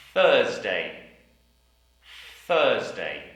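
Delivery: Thursday with a blown th; Thursday, th at the start; careful th in Thursday. Shown as a demonstration. Teacher drilling the word Thursday. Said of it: "And we have".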